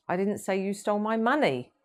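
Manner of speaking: "money" jumping high, then falling; stress on MONEY. Shown as a demonstration. Trainer shows how tone shifts meaning, stress on "money". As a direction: save the punch for "money" at the end. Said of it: "spoken in several different ways".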